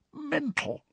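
high, thin croak, very soft; creaky whisper. A narrator's reading. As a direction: squeeze the word out in a high croak. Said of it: "he said in a croaky whisper".

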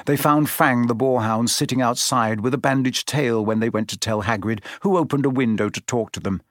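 plain reading voice

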